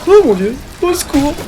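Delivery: high panicked call for help; panicked cry. Real, not acted. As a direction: yelp in fright. Panique face aux ennemis. (appel paniqué aigu)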